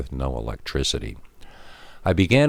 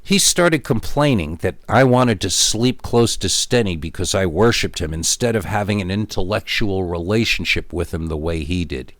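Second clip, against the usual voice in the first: plain reading voice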